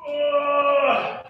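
short strained grunt of effort. Real, not acted. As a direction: grunt as the stretch peaks. Effort at end of stretch.

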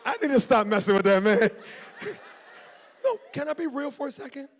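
loud, laughing self-correction; laughing at his own joke; laughing aside. Real, not acted. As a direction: laugh off the bit. Laughing at himself.

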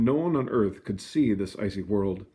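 plain reading voice

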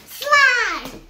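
loud excited yell; shouting. Acted. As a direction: belt out the word. Shouting slime.

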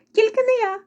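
very high little mouse voice; second mouse crying out. A narrator's reading. Second mouse dodging the work, very high cry. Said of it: "закричало друге мишеня".

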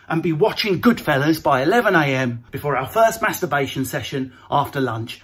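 normal talking voice

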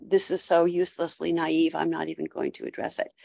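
low-pitched mock dismissal, quickening and softening at the end; imagined dismissive reply. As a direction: lightly voice a philosopher waving it away. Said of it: "going to say something like".

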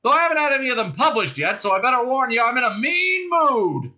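high, loud threat, slowing at the end; shouted warning. A narrator's reading. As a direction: yell a warning to the fugitives. Second cop yells a warning.

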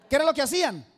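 loud shouted question to the crowd; calling out. Real, not acted. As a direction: demand an answer from the congregation. Preacher calls out to congregation. (pregunta gritada a la congregación)

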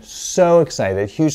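slow, emphatic and thrilled retelling; excited. Retold or imitated. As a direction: relive her excitement, stretching it out. Describing patient's excited arrival.

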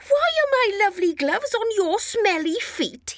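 high, indignant squeal of surprise; can't believe what he sees; shocked disbelief. Acted. Lennon couldn't believe his eyes.

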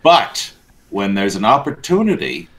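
ordinary talk

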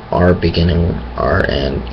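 ordinary talk, nothing marked

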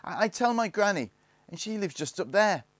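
high, loud, stumbling start; flustered threat. A narrator's reading. Barney threatens the gang with granny, high flustered voice. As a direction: blurt it out in a high voice. Cornered boy threatens to tell.

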